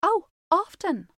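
high loud eager cry. Acted. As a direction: blurt it out eagerly. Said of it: "cried the little girl".

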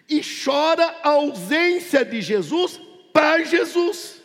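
slow, high, drawn-out sermon cries; impassioned pulpit lament; fervent preaching. Real, not acted. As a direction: stretch each phrase high with rising fervour. Preaches to congregation, rising fervour.

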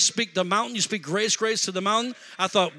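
ordinary delivery

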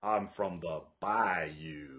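low, slow put-on Southern bayou accent; mock Southern bayou accent. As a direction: drawl it like a bad Southern film accent. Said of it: "his terrible accent".

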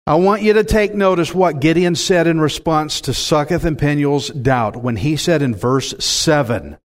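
ordinary talk, nothing marked

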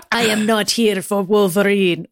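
slow, hammed-up Russian villain; over-the-top Russian accent. A narrator's reading. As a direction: overdo a heavy Russian accent. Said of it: "over-the-top Russian accent".

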